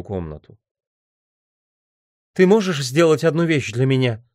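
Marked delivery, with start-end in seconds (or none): 2.3-4.2 higher voice, calling out a plea; raised pleading woman's voice; calling after someone. A narrator's reading. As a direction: call after him as he leaves. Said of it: "крикнула она вслед".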